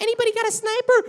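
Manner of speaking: put-on squeaky twelve-year-old gamer; chirpy young boy; high kid voice. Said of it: "I'm a twelve-year-old on Xbox".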